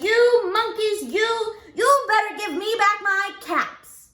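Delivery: high, loud angrier scold; quite angry. A narrator's reading. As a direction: raise the scolding a notch. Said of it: "Now he felt quite angry".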